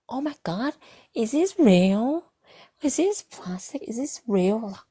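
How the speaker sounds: high-pitched, drawn-out imitation of a friend; mock excited squeal. Friend grabs the gun as they leave, high mock squeal.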